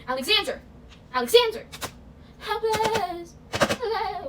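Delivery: high, loud mock cries; theatrical calling out. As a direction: belt it out like a musical number. Mimics daily Hamilton name calls.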